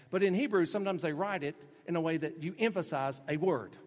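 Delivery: usual speaking voice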